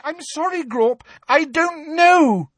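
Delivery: very high plea, rising to a loud wail; terrified squeal. A narrator's reading. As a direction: plead in squealing panic with a giant. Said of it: "squealed Hermione, terrified".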